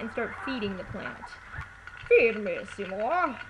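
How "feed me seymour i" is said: a loose, half-hearted Audrey with a hint of Sean Connery; mock Audrey calling out to Seymour; Audrey voice attempt. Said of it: "don't even know that was really Audrey too".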